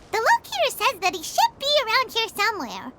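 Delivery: ordinary talk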